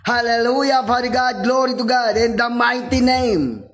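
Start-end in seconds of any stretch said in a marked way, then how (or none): none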